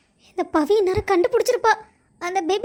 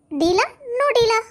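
playful; 4 semitones higher, much slower than usual. Acted. Striking a deal playfully.

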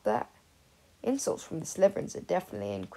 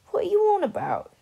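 higher and louder, puzzled; baffled; 12 semitones higher, 8 dB louder than usual. Acted. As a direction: demand an explanation, confused. Sirius baffled by Remus.

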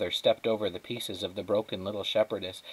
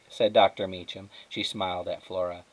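ordinary delivery, nothing marked

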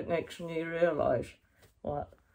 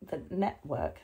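ordinary talk, nothing marked

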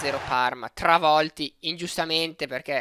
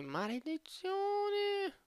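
anguished groan; 14 semitones higher, 12 dB quieter, much slower than usual. Real, not acted. Regrets overpaying a player.

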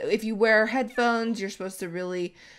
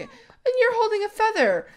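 much higher and louder; surprised; 13 semitones higher, 5 dB louder than usual. Real, not acted. Noticing the fox holds a feather, surprised. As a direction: pop up in amused surprise.

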